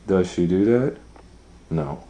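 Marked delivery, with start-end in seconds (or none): none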